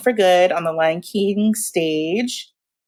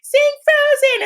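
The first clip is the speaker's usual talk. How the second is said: put-on high squeaky kid voice, slower; kids' voice; slower than usual. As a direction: chime in like an excited little kid. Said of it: "the kids were like".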